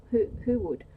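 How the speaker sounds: startled, forceful questioning; loud incredulous question. Acted. Startled question in acted lovers' dialogue.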